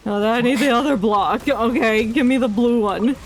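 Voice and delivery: ordinary delivery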